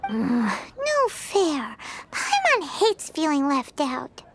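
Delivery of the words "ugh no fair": low, slow, sulking groan of complaint; much lower, slow, drawn-out no fair; sulky grumble. Acted. Left out of the fun.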